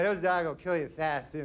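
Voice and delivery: ordinary talk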